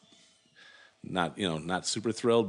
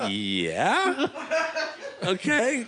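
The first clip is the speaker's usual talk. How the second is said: laughing acceptance; 4 dB louder, much slower than usual. Retold or imitated. Retells laughing reply to job offer.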